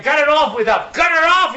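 American tourist telling the guide to stop, mock shout; retold shouting American. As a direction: bark it like an annoyed tourist. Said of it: "said the American".

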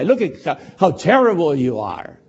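ordinary talk, nothing marked

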